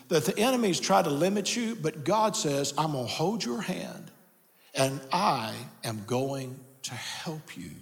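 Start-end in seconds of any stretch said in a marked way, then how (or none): 2.8-7.7 low, soft and slowing right down; slow it into a quiet, steady promise; reassuring promise. Acted. God promises a struggling listener his help, slow and reassuring.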